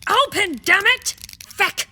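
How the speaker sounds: loud frustrated curse; frustrated cursing. Acted. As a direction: swear at the stubborn lock. Frustrated lock won't open.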